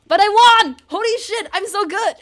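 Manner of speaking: loud, high victory cry; elated shout. Real, not acted. Gamer cheering a surprise win, loud and high. Winning a rhythm level.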